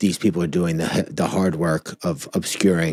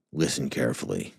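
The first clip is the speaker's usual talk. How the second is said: softer, lower menacing hacker voice; Anonymous hacker voice; 4 semitones lower, 8 dB quieter than usual. Shown as a demonstration. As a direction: read it like a faceless hacker group. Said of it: "Like Anonymous?".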